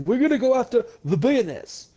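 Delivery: high, shouty Bernie Sanders rally voice; Bernie impression. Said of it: "He's like".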